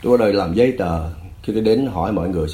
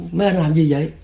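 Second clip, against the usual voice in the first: raised-pitch retold question; alarmed challenge; 7 semitones higher, 3 dB louder than usual. Narrator confronting refugees pinning the man down, alarmed. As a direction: challenge the attackers, voice jumping up. Calls out to men attacking Phước.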